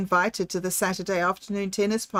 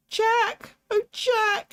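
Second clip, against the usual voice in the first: much higher, tearful cry of a grieving wife; 14 semitones higher, much slower than usual. Acted. Mother cries out to her son after her husband's death, high and tearful. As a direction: wail his name in high distress. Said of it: "she cried".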